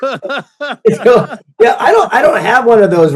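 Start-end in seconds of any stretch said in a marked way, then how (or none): 0.0-0.5 laughs through the punchline; laughing. Real, not acted. Laughing at his own rich-kid joke. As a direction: chuckle the word out as a joke.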